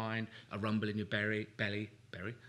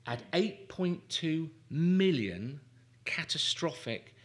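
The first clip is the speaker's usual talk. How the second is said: slow, drawn out, higher and louder, leaning on every word; slow emphatic exaggeration; 5 semitones higher, 4 dB louder, slower than usual. Real, not acted. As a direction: stretch the huge number out for effect. Exaggerating Mary's thought count.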